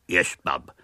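put-on low, dull slum-boy reply; lightly throaty boy. A narrator's reading. William in disguise answering Miss Milton, dull and low. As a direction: mumble like a slow lad.

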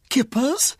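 high, breathless whispered gasp; a high, voiceless gasp of a word; shocked whisper. Acted. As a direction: whisper it in disbelief. Lady aghast at kippers.

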